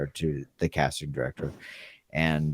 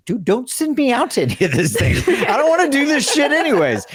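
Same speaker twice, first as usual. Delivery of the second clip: fast, much higher, laughing complaint; exasperated rant; 4 dB louder, faster than usual. Retold or imitated. On the phone to his agent, a fast exasperated rant. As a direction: blurt it out fed up.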